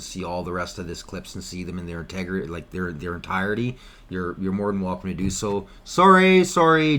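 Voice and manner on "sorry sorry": sarcastic apology. Acted. Mock apology to critics.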